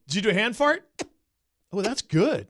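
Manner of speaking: tickled disbelief at a hand fart; amused surprise. Real, not acted. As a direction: catch the joke mid-laugh.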